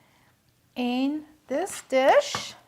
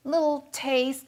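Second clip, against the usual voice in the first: usual speaking voice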